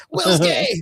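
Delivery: very high, loud mocking reveal; villain's gotcha line. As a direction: blurt it like a cheap shot. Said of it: "he goes".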